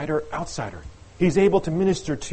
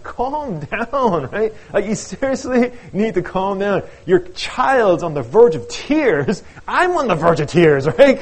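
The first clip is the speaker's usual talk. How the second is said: loud, higher-pitched exasperated outburst; drawn-out, pleading exasperation, building louder; exasperated plea; 6 semitones higher, 8 dB louder, slower than usual. Acted. Fed-up parent in the stands begging others to calm down, exasperated.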